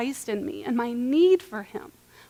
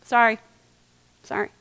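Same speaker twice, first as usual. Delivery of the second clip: half-hearted sorry. Retold or imitated. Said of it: "Isn't it a lot easier to give up".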